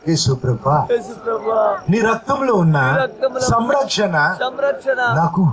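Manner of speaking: earnest pleading prayer, phrase by phrase; fervent prayer. Acted. Pastor leading the congregation's prayer aloud, fervent pleading prayer. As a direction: pray it aloud for all to follow.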